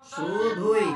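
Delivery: শুধুই said loud and distinct; clear pronunciation. Shown as a demonstration. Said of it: "আমরা বলি শুধুই".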